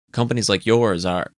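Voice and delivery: ordinary delivery, nothing marked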